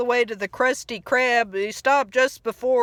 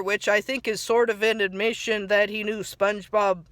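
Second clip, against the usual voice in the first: ordinary talk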